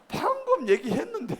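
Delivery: high, loud playful complaint; exasperated teacher, half joking; mock exasperation. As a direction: protest that you only just said it. Congregation misses the just-taught answer.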